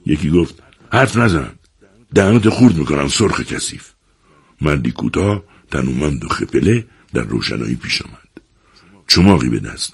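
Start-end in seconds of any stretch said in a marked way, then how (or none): 0.9-3.9 angry threat. Acted. Thug threatens a striker.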